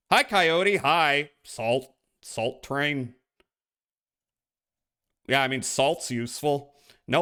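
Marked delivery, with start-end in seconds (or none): none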